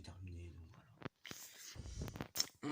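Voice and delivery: soft unvoiced whisper; whispered aside. Real, not acted. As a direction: breathe it out as a whisper. Trailing off into a whisper.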